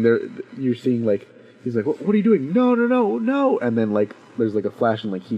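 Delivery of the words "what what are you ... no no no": rising, higher and higher no, no, no; panicked cry. Retold or imitated. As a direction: imitate a man's panic as the flash hits. Wally's panicked cry before dying.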